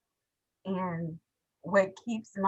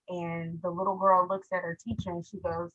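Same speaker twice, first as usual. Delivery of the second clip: ordinary delivery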